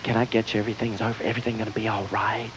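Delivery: hushed, low fussing over a victim; victim-coddling sympathy. Retold or imitated. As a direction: smother her with pity. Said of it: "they go right for that victim thing".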